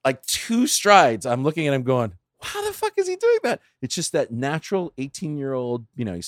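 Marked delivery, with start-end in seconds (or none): 2.4-3.6 very high, squeaky astonishment; retold shock, voice leaping way up; stunned disbelief. Dad losing the race to his son, stunned.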